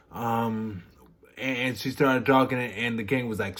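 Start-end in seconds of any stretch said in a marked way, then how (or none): none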